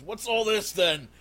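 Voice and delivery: loud, high officious demand; guard in charge. Acted. Guard captain rushing into the room, demanding. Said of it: "one of the guys in charge goes".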